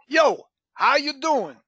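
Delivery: slow, drawn-out Brooklyn greeting, pitched high; Brooklyn accent. Shown as a demonstration. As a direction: demo a playful put-on Brooklyn accent. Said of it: "who could pretend they're from Brooklyn".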